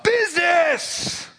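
a drawn-out, high cry of the ghost; ghost's cry. Acted. The ghost of Marley cries out to Scrooge, a high drawn-out cry. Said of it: "he yells out".

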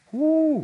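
high, loud joyful whoop; a real burst of joy; excited whoo. Real, not acted. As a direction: let out a happy holler. Joy while recalling prayer.

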